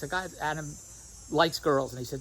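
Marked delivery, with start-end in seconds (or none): none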